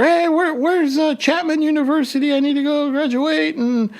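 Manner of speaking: high, frantic mock yell; screaming at her. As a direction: call out to a stranger in a panic. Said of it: "was screaming at her".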